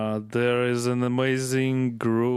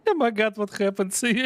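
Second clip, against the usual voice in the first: fast, high, amused disbelief; 9 semitones higher, faster than usual. Real, not acted. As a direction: gush out in delighted shock. Laughing at glitched graphics.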